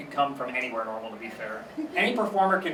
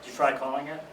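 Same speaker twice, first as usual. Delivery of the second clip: deadpan echo; 2 semitones lower, 5 dB quieter than usual. Retold or imitated. Grandson echoing grandmother's own advice, soft deadpan. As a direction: hand it back drily, pitched down. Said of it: "So I do the".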